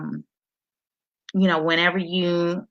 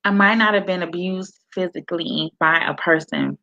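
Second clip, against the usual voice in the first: ordinary talk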